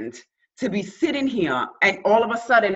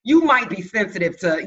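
ordinary talk, nothing marked

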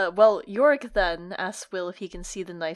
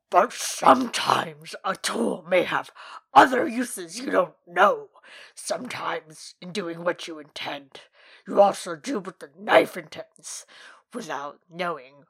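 Yorick dinosaur voice; 4 dB louder than usual. Acted. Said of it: "great quote from Yorick of".

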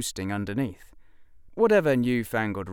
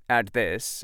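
ordinary delivery, nothing marked

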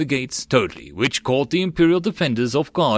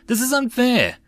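indignant whine; 13 semitones higher than usual. A narrator's reading. Soldier under fire complaining.

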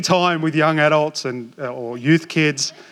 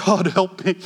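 laughing plea. Retold or imitated. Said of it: "I'm like".

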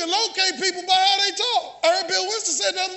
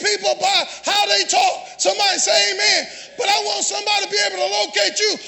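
preacher's rising list, each phrase punched harder; full-force preaching; 4 semitones higher, 5 dB louder than usual. Real, not acted. Preacher urging church members to be recognisable, full-force preaching. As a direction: build to a loud, emphatic finish.

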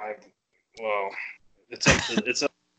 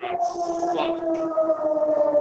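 sound clip. Pretend.

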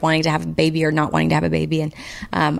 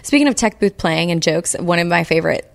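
ordinary talk, nothing marked

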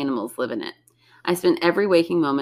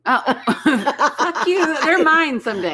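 laughing; 11 semitones higher than usual. Real, not acted. Laughing while protesting.

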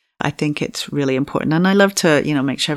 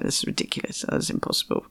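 much softer, breathy stunned disbelief; in shock; 8 dB quieter, much faster than usual. Retold or imitated. Retelling her shock at the pregnancy, barely voiced. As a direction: whisper it like you can't believe it. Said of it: "in shock".

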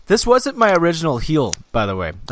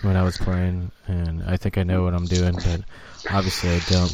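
low, self-deprecating ex-manager impression; Mike Matheny; 6 semitones lower than usual. Co-host sending up the fired baseball manager, low-voiced impression. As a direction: play the former manager in a dropped, low voice. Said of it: "and that was Mike Matheny".

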